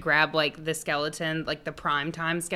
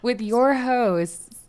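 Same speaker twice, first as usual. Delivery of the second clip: slow, soft and soothing welcome; calm hostess voice, eased out; soft safe voice; 6 semitones higher, slower than usual. Acted. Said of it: "I'm gonna speak in my safe voice".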